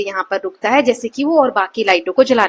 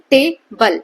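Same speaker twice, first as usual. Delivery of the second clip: Table slowly in two beats, टे, then बल; split into syllables; 4 dB louder, much slower than usual. Shown as a demonstration. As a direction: pause inside table, between टे and बल. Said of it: "जिसमें pause आ रहे हैं दो बार".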